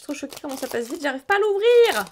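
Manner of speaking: high, loud, flustered cry; real excitement spilling over while tearing the pack; excited frustration. Real, not acted. Struggling to open the pack.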